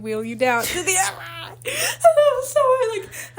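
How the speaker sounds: high, squeaky laugh through the words; embarrassed laugh breaking up the sentence; laughing in embarrassment. Real, not acted. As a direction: dissolve into laughter, stretching the words out. Laughing, embarrassed retelling of wheelchair.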